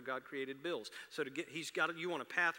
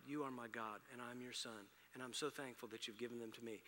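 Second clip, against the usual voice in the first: soft, grateful prayer voice; demonstrating a prayer; 4 semitones lower, 5 dB quieter than usual. Shown as a demonstration. Pastor shows the congregation how to pray over pills, softly. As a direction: pray it quietly and sincerely. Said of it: "Here's how you do it".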